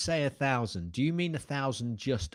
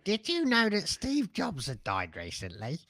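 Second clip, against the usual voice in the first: mock old-timer, pitched up high; doddering oldster; 6 semitones higher than usual. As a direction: play an out-of-touch old podcaster, voice raised. Imagining themselves as doddering old podcasters.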